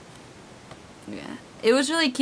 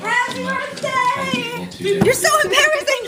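high, loud, laughing birthday shout; 6 dB louder, slower than usual. Real, not acted. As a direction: burst in yelling it. Surprising the boy.

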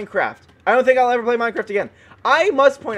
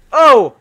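raised, loud cry; startled yelp; 4 semitones higher, 11 dB louder than usual. Real, not acted. Gamer caught by the Lichen, startled yelp. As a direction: yelp in sudden surprise.

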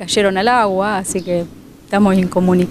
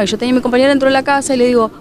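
ordinary talk, nothing marked

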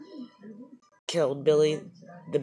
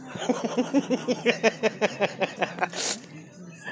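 laughing; 7 semitones higher than usual. Real, not acted. Wife laughs at her joke.

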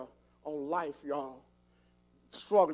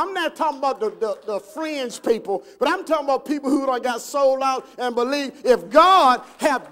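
loud, raised preacher's rallying call; booming questions to a congregation; full-force preaching; 8 semitones higher, 10 dB louder than usual. Real, not acted. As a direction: fire up the congregation, high and loud.

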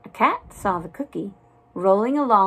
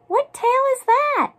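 very high little mouse voice; high-pitched childlike question; eager mouseling; 4 dB louder than usual. A narrator's reading. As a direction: squeak it out like a curious mouseling. Little mouselings ask their storyteller.

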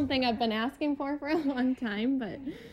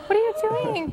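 higher, louder startled question; surprised; 6 semitones higher, 4 dB louder than usual. Real, not acted. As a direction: caught completely off guard. Anchor surprised by the proposal.

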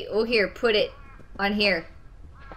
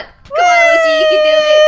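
calling out; 13 dB louder than usual. Real, not acted. Cheering friend on in game.

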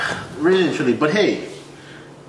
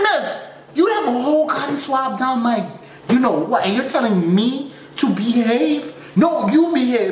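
high, strained, outraged voice; a hammed-up complaint to the nurse; mock indignant protest; 9 semitones higher than usual. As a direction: protest in a high pitch, half-laughing.

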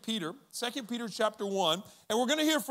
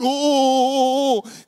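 loud, high drawn-out ooh; a jeering locker-room ooh; crowd ooh; 4 semitones higher, 13 dB louder than usual. Retold or imitated. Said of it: "They all went".

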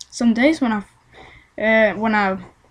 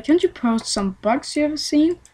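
ordinary talk

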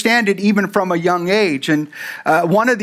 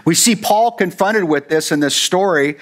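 normal talking voice

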